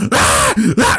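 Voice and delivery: short high screams, mimicked; mock scream. Storyteller acting out a girl's screaming, mock screams. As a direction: imitate a girl's sudden screams. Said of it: "she starts screaming".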